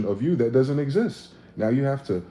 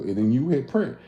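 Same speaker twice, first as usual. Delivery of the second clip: ordinary talk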